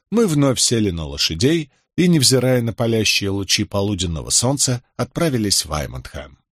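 usual speaking voice

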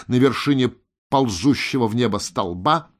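ordinary delivery, nothing marked

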